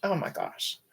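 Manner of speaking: choked whisper on the edge of crying; wife grieving her dog, whispered and choked; fighting tears. Real, not acted. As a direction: whisper through real tears. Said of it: "okay, I'm gonna cry".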